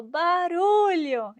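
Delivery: high, slow drawn-out shout of the word Barulho; mock nurse shout. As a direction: yell Barulho slowly like an impatient nurse. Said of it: "E gritava mais alto".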